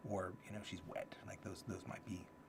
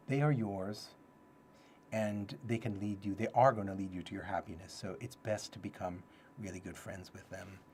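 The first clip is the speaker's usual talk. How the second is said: ordinary talk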